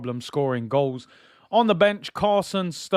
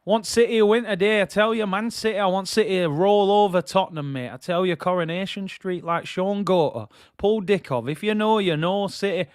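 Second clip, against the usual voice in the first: put-on Mancunian accent, pitched up; higher-voiced imitation Manchester accent; 4 semitones higher than usual. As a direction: talk like a proud Manchester City fan, full Mancunian. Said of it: "that's not a bad Manchester accent".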